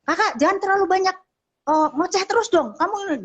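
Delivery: high-pitched imitation of a parent's telling-off; retold scolding. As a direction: mimic a parent chiding a chatty child. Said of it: "Perhatikan cara kamu negor".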